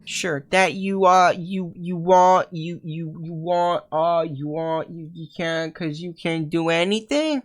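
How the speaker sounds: high, slow, halting stammer. Acted. Voicing a stammering character.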